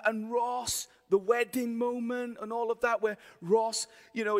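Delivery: ordinary talk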